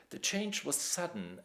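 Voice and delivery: ordinary delivery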